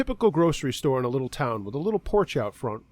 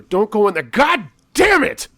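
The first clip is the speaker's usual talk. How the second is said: frustrated outburst; 10 semitones higher, 9 dB louder than usual. Acted. Clerk cursing as bloody man enters store, loud outburst. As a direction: blow up as he walks in. Clerk's mantra breaks into a curse.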